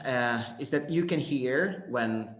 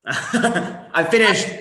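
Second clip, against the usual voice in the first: laughing; 6 semitones higher, 6 dB louder, much slower than usual. Real, not acted. Laughing as she finishes.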